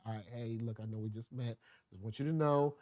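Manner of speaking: low, hushed acted approach; softly easing in. Speaker acting out warming someone up first, softly easing in. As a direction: ease in gently, low and very soft.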